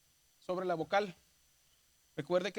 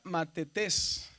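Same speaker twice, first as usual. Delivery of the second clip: "matetés" spelled out by syllable, landing on tés; stress on last syllable; much slower than usual. Shown as a demonstration. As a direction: say "matetés" slowly, leaning on the final tés. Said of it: "está en la última sílaba".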